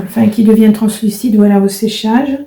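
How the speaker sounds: ordinary talk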